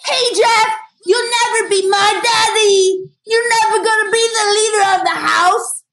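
high, loud taunt at a stepdad; mock defiant shout. Kid challenging mom's special friend, defiant shout. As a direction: yell it like a bratty, defiant kid. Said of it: "you can't say things like".

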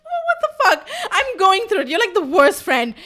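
high squeal breaking into laughter; laughing, loud mock outrage; giggling protest. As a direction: protest through helpless giggles. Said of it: "I actually start giggling with her".

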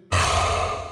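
heavy exasperated sigh; human-sounding sigh. A narrator's reading. Dark god asked to turn on a light, exasperated sigh. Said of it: "He let out a very human-sounding sigh".

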